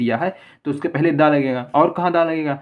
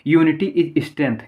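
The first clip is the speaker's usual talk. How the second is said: slow example reading; much slower than usual. Shown as a demonstration. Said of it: "अब देखिए".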